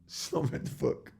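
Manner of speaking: soft, unvoiced giggling repeat; laughing whisper. Real, not acted. Repeating to himself that she hasn't read the book, whispered laughing. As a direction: laugh it out under your breath.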